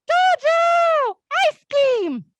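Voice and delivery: put-on high, loud, gleeful child voice; a toddler delighted to reach the ice cream shop, high excited squeal. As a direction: squeal Dojo like a thrilled little boy. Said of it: "And he's like".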